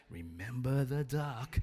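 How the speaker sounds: slow, mock-menacing reminder; veiled threat. Sister to Johnny after offering his help, slowly menacing. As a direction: draw it out as a knowing threat.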